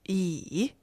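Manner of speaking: yi low, dipping then lifting; third tone. Shown as a demonstration. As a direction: drop yi low and dip it. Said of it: "Third tone".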